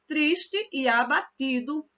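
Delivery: Triste e abatido drawn out, triste and e fused into one syllable; teacher showing elision; slow elided demo. Shown as a demonstration. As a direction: read it slowly, swallowing the e. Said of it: "que se lê".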